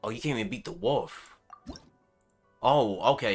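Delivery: ordinary talk, nothing marked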